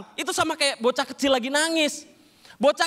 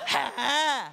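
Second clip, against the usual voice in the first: mock possessed shouts; 5 semitones higher, much slower than usual. Possessed man ignored, hammed-up shouts. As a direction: ham up the possession.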